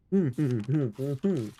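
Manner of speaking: low humming in a man's register; Dartigan. Acted. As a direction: hum down to imitate him. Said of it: "do your Dartigan impression".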